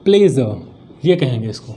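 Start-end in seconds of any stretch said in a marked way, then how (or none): 0.1-0.6 प्लेजर as "ple-zuh", pitched low and falling; teacher's low, falling "ple-zuh" for प्लेजर; correct pronunciation. Shown as a demonstration. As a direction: model प्लेजर with z and no final r. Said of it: "क्या कहेंगे?".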